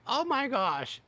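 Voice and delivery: high "oh my gosh" in disbelief; stunned surprise; shocked. Retold or imitated. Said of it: "And I was like".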